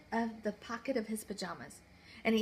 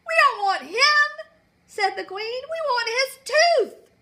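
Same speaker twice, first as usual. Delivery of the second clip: high, loud, imperious queen; haughty queen; 14 semitones higher, 13 dB louder than usual. Acted. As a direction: command it like a spoiled queen.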